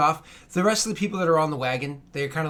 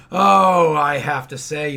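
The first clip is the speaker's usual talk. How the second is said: big booming "oh", then slowing; hearty admiration; 9 dB louder, much slower than usual. Acted. Caravan companion praising the hunter, hearty admiration. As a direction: open loud with jovial admiration.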